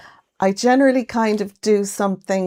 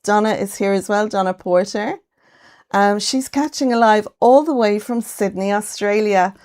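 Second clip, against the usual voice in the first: usual speaking voice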